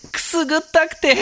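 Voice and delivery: ticklish giggly voice; giggling from the tickle; being tickled. Acted. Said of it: "変な声出すなよ".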